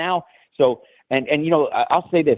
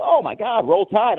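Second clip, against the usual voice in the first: high, thrilled burst of recognition; retelling an excited shout to a Bama stranger; excited fan greeting; 8 semitones higher than usual. Retold or imitated. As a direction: call it out like you just spotted a fellow fan. Spots fellow fan at mall.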